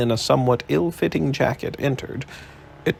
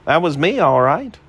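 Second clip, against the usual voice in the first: lightly voiced jaunty reporter, a bit higher; cheeky reporter; 5 semitones higher, 5 dB louder than usual. A narrator's reading. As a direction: breeze in with mock politeness. Malone voiced, pleased.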